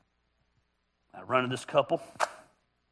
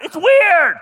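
higher and much louder, almost shouting; loud exclaim; 11 semitones higher, 12 dB louder than usual. Shown as a demonstration. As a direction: blurt it out a second time, much louder and higher. Repeats phrase, second much louder.

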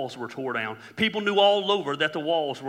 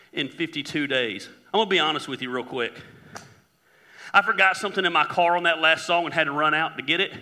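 ordinary talk

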